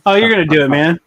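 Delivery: real burst of loud encouragement. Real, not acted. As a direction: cheer a friend on at full volume. Cheering on friend before competition.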